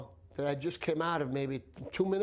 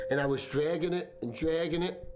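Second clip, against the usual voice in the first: usual speaking voice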